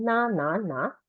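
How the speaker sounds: 哪 said nǎ, dipping third tone. Shown as a demonstration. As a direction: dip and rise on 哪. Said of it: "哪 is third tone".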